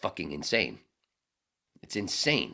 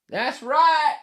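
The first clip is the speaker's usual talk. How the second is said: very high, loud outburst; emphatic shout; 15 dB louder than usual. Acted. Host confirming most people don't know him, emphatic shout. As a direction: belt it out high and hard.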